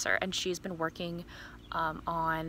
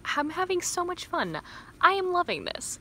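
gushing; 10 semitones higher than usual. Real, not acted. Gushing about the book.

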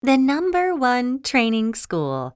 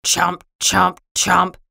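short, punched munching sounds; chomping tree; 3 dB louder than usual. Acted. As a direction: chomp out each word abruptly. Tree eating the piano.

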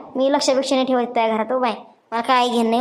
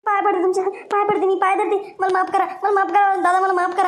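higher, frantic begging; desperate pleading; 6 semitones higher than usual. Acted. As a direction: beg him, terrified of being exposed. Begging him to delete video.

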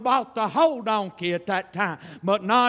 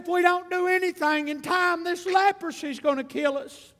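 much higher, pleading lepers at the peak; climbing desperation; 6 semitones higher than usual. Retold or imitated. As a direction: push the lepers' plea up high. Lepers voiced in sermon story.

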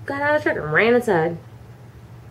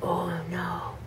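unvoiced whispered dismay; 3 semitones lower, 13 dB quieter than usual. Girl hearing dad curse, whispering. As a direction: whisper it in dread. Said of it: "Kimberly's like".

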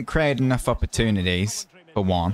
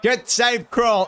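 rising excitement; 10 semitones higher than usual. Real, not acted. Gamer calling Vardy's breakaway, climbing excited commentary. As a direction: pitch climbing high as Vardy shoots.

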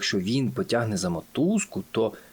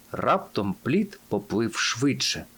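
ordinary delivery

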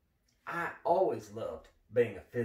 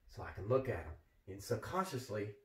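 normal talking voice

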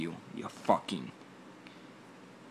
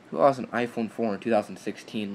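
usual speaking voice

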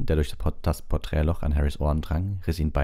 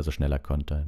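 usual speaking voice

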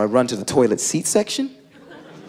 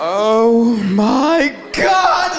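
high, very drawn-out horrified cry; mock screaming; 8 semitones higher, much slower than usual. Manager finding out about the poop, slow high mock scream. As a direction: stretch every word into a rising scream. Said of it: "he's screaming".

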